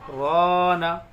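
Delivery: Rana said alone after a stop; resume after a pause. Shown as a demonstration. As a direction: start fresh on Rana. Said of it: "दो तीन second रुक के फिर पढ़ेंगे".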